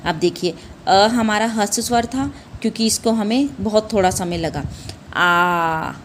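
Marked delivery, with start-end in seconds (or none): 5.1-5.9 आ held long with the mouth wide open; long vowel drawn out. Shown as a demonstration. Teacher to children showing a long vowel, drawn out. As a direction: stretch आ out, double length. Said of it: "पूरा मुंह खोला ना आपने".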